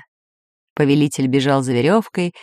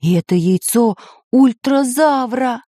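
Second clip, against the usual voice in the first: higher-pitched, aghast cry; alarmed exclamation; 7 semitones higher than usual. A narrator's reading. Geographer clutches his head at the egg, aghast. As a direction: exclaim in disbelief. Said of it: "схватился за голову географ".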